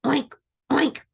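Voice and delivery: short piggy oinks; grunting pig. Acted. Pig calls to the spider, grunting oinks. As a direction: oink it like a storybook pig. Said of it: "grunted the pig".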